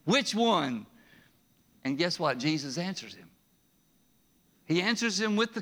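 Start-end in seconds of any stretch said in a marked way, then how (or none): 0.1-0.9 high, insistent haggling; pushy bargaining. Retold or imitated. Preacher mocking the rich man haggling over commandments. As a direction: demand the loophole, pressing higher. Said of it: "No, he's like".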